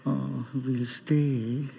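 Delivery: low, soft, drawn-out giving in; sheepish. Retold or imitated. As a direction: back down meekly, slowly and under your breath. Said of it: "very sheepishly".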